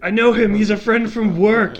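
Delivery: high, loud Thor quote; quoting Thor. Retold or imitated. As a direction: toss off the movie line brightly. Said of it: "is maybe the best thing".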